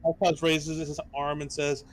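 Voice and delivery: ordinary talk, nothing marked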